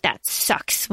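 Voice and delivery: low, breathy dismissive scoff; dismissive critic. Retold or imitated. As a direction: brush it off like a critic. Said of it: "be critical and be like".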